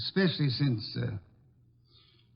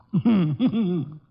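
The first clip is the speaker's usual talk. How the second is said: hearty laugh; 3 semitones higher, 5 dB louder than usual. Acted. Wolfe enjoying his beer joke, hearty laugh. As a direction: laugh richly at your own joke.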